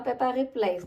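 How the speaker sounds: ordinary delivery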